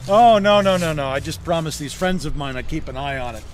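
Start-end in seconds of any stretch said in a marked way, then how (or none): 0.1-1.1 a sudden loud burst of no's, quickly softening; hasty loud denial. Acted. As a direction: wave off the question fast and loud. Quick denial of owning house.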